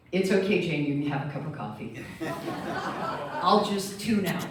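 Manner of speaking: loud call from the next room, laughing mid-way; amused shout through the wall. Retold or imitated. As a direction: call out across the apartment. Calling from spare bedroom.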